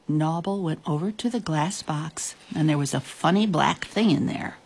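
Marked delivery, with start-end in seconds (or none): none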